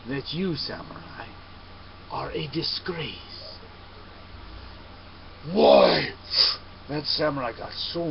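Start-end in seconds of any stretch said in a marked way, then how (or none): 0.1-3.6 soft, slow and cutting; calm insult. Acted. Monk calmly insults samurai. 5.5-6.1 loud, angry demand; furious "why". Acted. Samurai enraged.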